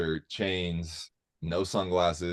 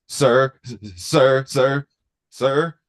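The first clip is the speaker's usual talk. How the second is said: repeated sir, calling louder and higher; guard calling out; 5 semitones higher, 7 dB louder, slower than usual. Retold or imitated. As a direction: call after him like an anxious guard. Guard calling after him.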